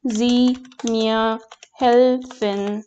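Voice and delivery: slow, drawn-out model phrase; teacher modelling können Sie mir helfen at half speed; slow careful demo. Shown as a demonstration. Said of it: "to be more polite".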